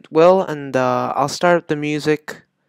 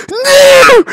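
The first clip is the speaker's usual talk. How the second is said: high, loud scream of NO; frustrated scream; 11 dB louder than usual. Real, not acted. Gamer failing the jump, frustrated scream. Fails in rage game.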